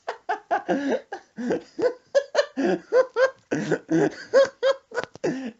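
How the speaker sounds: high, long wheezing cackle; imitated grandma laugh. Grandma cracking up again.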